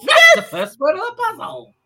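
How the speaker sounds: high, loud mock exclamation; hammed-up royal excitement; excited king. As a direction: crow it like a delighted king. Acting out the delighted king.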